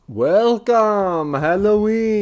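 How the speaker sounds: high, loud, drawn-out announcer welcome; hammed-up big show-opening welcome; same old show intro. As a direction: belt out the intro high and long. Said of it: "instead of the same old".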